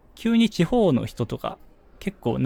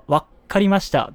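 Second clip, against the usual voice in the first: lightly mimicked businessman, a little higher-pitched; slightly pitched-up imitation of a salaryman's reply; salaryman impression; 5 semitones higher, 4 dB louder than usual. Imitating a salaryman on the job for a friend, lightly hammed-up businessman voice. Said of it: "サラリーマンのモノマネとかの時にさ".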